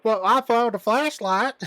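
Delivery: hammed-up Southern impression; Franklin's Southern accent. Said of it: "bizarrely super strong Southern accent".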